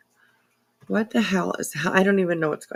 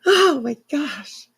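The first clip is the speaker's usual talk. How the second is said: ordinary talk, nothing marked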